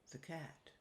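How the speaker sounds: ordinary delivery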